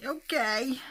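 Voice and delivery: high, loud, sheepish okay; chastened little okay; mock meek reply. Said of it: "And I'll say".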